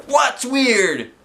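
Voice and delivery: high hollered question from the crowd; heckler holler. A narrator's reading. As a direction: holler it from the back rows. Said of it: "somebody hollered".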